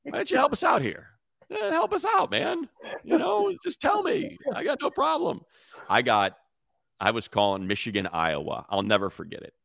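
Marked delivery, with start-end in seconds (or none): 0.0-5.4 mock exasperated plea. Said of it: "It's like".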